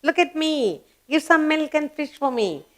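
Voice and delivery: high-pitched, lightly acted meowing cat; cat's voice. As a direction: speak up high as a little cat asking to be fed. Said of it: "He says".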